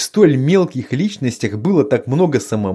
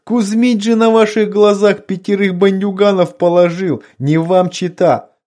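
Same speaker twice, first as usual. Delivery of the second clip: laughing; 6 semitones higher, 4 dB louder than usual. A narrator's reading. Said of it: "Утирая слёзы, выступившие от хохота".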